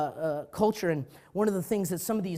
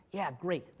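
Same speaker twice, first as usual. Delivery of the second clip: usual speaking voice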